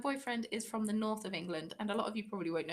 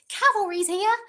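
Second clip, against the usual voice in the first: loud, high imitation of an irritating hero; mock a grating, overexcited battle cry; annoying game heroine; 10 semitones higher, 14 dB louder than usual. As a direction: belt it out high, mimicking someone annoying. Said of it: "her voice is so annoying".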